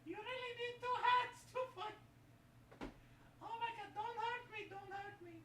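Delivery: high soft squeaky voice; squeaky high character voice; high puppet voice. Acted.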